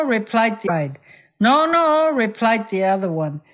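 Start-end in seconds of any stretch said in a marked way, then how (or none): 1.4-2.1 defiant refusal. Acted. Friend refuses, acted quarrel.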